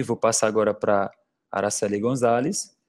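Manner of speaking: ordinary talk, nothing marked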